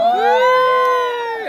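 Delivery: very high, squealing yay; excited cheer. Real, not acted. Cheering the birthday greeting with a high squeal. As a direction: squeal with delight.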